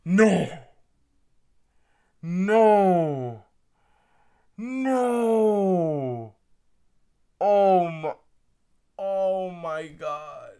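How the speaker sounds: loud anguished no; shocked shout. Real, not acted. Fan shouting as rival trades up for his player. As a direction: cry out in disbelief.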